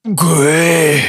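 a gasped, wounded command. A narrator's reading. Wounded Zold urging Maya away, gasping. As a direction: force the word out, short of breath. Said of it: "keuchte er".

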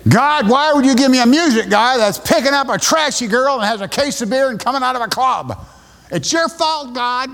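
high, blaming outburst, loud at the start; angry complaint to God. Retold or imitated. Young pastor blaming God over the music leader, high angry outburst. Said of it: "I threw it in anger".

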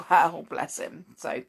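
usual speaking voice

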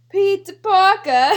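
high, loud mock British accent; exaggerated British call. British people watching Spider-Man, mock British accent. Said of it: "British people walking, watching Spider-Man".

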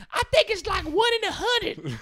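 dim classmate. Retold or imitated. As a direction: play a clueless girl. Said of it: "some pregnant chick's like".

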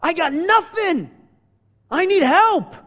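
high, drawn-out desperate plea; crying out for help. Retold or imitated. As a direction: act out a woman crying out for help. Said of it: "she cried out to him".

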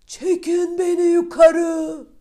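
high, loud, slow drawn-out cry; shout. Acted. Middle son in the well shouting to be pulled up. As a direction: yell up the well in fright. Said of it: "diye bağırmış".

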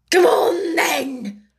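high, loud bellowed command; roared. A narrator's reading. The Queen summons Alice to croquet, roaring. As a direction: roar the summons. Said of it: "roared the Queen".

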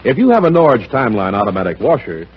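ordinary talk

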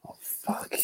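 breathy whispered swear to himself; whispered curse. Real, not acted. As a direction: let it slip under your breath. Muttered aside, off-mic.

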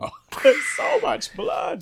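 high, loud, laughing through the words. Real, not acted. Friend recalling the gory blood scene, laughing. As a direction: giggle it out in delight.

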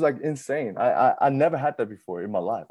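ordinary talk

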